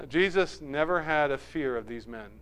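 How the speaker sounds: ordinary delivery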